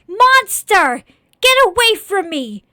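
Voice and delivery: high, very loud scream of revulsion; terrified yelling. Acted. Boy screams at the scarecrow to leave, terrified yelling. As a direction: yell it to drive the creature off. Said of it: "Please stop yelling".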